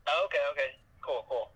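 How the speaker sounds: usual speaking voice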